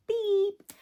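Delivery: high squeaky bleep sound in place of a word; a high vocal beep; mock censor beep. Shown as a demonstration.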